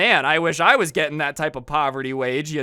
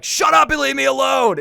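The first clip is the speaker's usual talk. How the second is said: high, loud bratty shout; mock yell; 10 semitones higher, 8 dB louder than usual. Kid yelling at his parents to leave him alone, loud.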